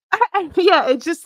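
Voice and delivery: high giggly words; can barely talk for laughing. Real, not acted. Laughing during exercise.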